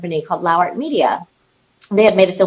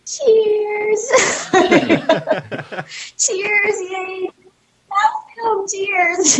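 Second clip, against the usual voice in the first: forced cheer; 11 semitones higher, much slower than usual. Retold or imitated. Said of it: "So I was like".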